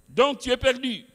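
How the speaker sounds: high, loud preacher's shout of doom; condemning shout. Retold or imitated. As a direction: thunder the condemnation at him. (cri de condamnation, aigu et très fort)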